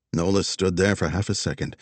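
plain reading voice

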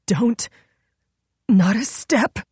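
high, strained warning; weakened warning. A narrator's reading. Wounded Nola warns Royal not to move, weakened warning. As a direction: warn with what little strength is left. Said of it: "her voice not nearly as strong as usual".

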